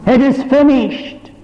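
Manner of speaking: preacher voicing Jesus crying out on the cross. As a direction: lift it like a final cry. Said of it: "I heard him cry".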